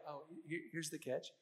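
mock wary aside. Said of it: "you're like".

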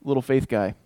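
a light mock scolding; teasing rebuke. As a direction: gently tease the doubter. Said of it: "It's that".

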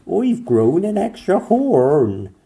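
high, loud, slow horsey whinny voice; whinny the words like a unicorn. A narrator's reading. Unicorn complaining to the doctors, whinnying. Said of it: "He pawed the ground and whinnied".

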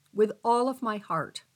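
ordinary talk

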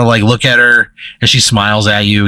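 normal talking voice

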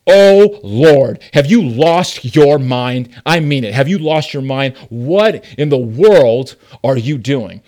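loud exasperated sarcastic rant at God; mock-outraged arrogant prayer. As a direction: scoff at the Lord like he's lost it. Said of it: "a very sarcastic and, and an arrogant tone".

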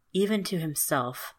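plain reading voice

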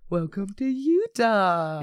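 slow, stretched-out mock greeting; mock welcome. Coworker replying to complaints about Mormons, drawn-out mock welcome. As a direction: draw it out as a wry welcome.

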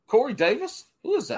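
puzzled question. Retold or imitated. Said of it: "she texts".

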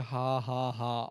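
imitated deadpan heckler; dry, monotone mock laugh; flat sarcastic laugh. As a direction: fake laugh with no amusement in it. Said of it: "Like, flat and sarcastic".

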